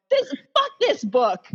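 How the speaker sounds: high, slow, laughing half-sob; in bed reading, laughing and cursing the book; laughing outburst. Retold or imitated. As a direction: blurt it through tears and laughter. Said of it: "I'm just going".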